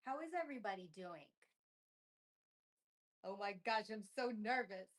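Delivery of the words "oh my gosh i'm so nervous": usual speaking voice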